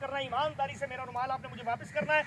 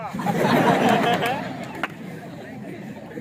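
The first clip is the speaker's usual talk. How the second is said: breathy laugh; 5 semitones lower, 3 dB louder than usual. Real, not acted. Laugh after the child's punchline.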